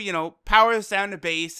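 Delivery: ordinary talk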